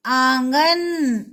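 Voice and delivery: आंगन drawn out very slowly with a long nasal आं; stretched nasal demo. Shown as a demonstration. Teacher stretches the word for pupils, very slow. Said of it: "आवाज आ रही है".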